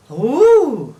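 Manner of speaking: delighted ooh. Acted. Toad delighted hearing Frog's letter, loud ooh. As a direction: marvel at the letter.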